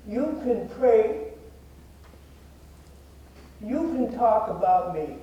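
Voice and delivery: real emotion interrupting the words; getting emotional. Real, not acted. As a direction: recite it while holding back emotion. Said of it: "I always get emotional when I say this".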